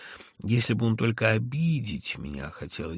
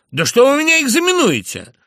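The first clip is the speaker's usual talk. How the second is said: very high, loud angry outburst; angry snap; 13 dB louder than usual. A narrator's reading. The narrator snaps at Zverkov for examining him. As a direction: burst out, losing control.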